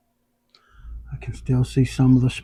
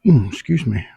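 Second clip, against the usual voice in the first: hiccuping mid-sentence; hiccups; 6 dB louder than usual. Real, not acted. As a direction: talk through a hiccup. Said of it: "I have the hiccups".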